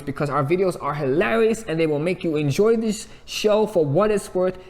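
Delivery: ordinary talk, nothing marked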